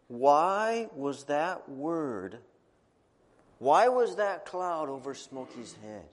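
very slow, drawn-out question. Shown as a demonstration. Teacher asks the children why, very slowly. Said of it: "teacher poses question slowly".

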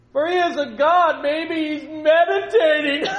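high, loud, slow sarcastic jab; mocking taunt. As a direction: drag out meditating in scorn. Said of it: "he was egging him on".

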